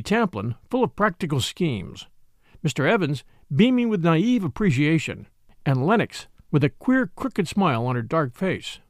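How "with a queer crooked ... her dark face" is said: ordinary delivery, nothing marked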